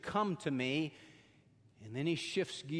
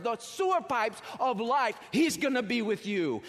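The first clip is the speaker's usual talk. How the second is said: louder and higher, a rising sermon climax; preaching at full force; 6 semitones higher, 4 dB louder than usual. Acted. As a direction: build it up like a revival preacher.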